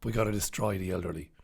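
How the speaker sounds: low, hushed, quick villainous aside; sinister establishment voice. Acted. Host mimicking the establishment plotting, low and soft. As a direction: slip it in low and under the breath.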